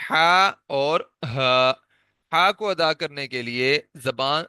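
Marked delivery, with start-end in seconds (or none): 0.1-1.7 ہا and ہا drawn out from the throat; slow letter demo. Shown as a demonstration. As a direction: sound out the two ہا letters slowly. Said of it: "اس کے بعد ہے جی".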